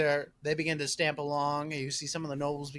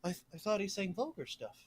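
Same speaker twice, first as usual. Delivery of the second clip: puzzled male nobles; 4 semitones higher, 8 dB quieter than usual. Acted. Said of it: "the male nobles are just like".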